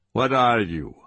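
quick, firm interrogator's question; short, insistent demand; brisk demand. Acted. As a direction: fire the question like a drill order. Interrogator barks amid drill count.

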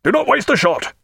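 high ringing battle cry; shouted orders. A narrator's reading. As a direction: urge the gunners on at full voice. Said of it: "he cried".

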